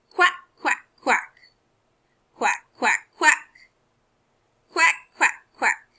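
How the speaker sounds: high, loud quacking like a duck. Pretend. Teacher voicing the duck in the reading sentence, quacking.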